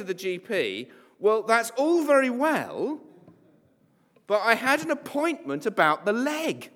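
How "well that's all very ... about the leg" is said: indignant protest. Pretend. Patient objecting to the doctor.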